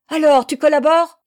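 very loud, high angry challenge; belched-out demand. A narrator's reading. Girl raises a stick over the woman, angry demand. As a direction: spit out the question violently. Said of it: "éructa la jeune fille".

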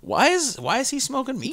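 very high put-on questioning voice; mock bafflement. Viewer reacting to a meme video, high mock bafflement. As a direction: jump up into a squeaky, puzzled voice.

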